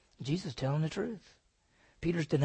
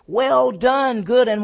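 louder and higher, jubilant; ringing praise; 8 semitones higher, 13 dB louder, slower than usual. Retold or imitated. Preacher voicing Jesus praising a faithful servant, ringing out. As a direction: call it out like a reward.

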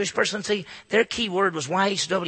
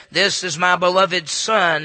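voice from heaven; 7 dB louder, slower than usual. Acted. Voice from heaven quoted.

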